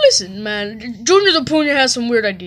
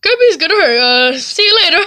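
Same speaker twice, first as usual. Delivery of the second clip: ordinary talk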